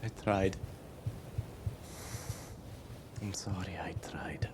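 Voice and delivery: very soft, near-whispered regret; broken apology. Acted. Game master as dazed, weeping survivor apologising, broken. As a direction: barely get it out, numb with grief.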